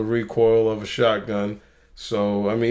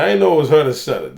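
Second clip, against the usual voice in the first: usual speaking voice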